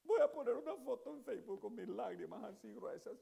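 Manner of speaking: preacher mocking a member who complains in tears; mock self-pitying complainer. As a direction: ham up a dramatic martyr moaning about his trials. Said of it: "en vez de estarte quejando".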